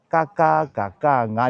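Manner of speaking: 嘎 said with mouth open; don't close your lips on 嘎嘎嘎嘎啊; 张嘴发音; open mouth sounds. Shown as a demonstration. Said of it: "你看我嘴巴没有关掉".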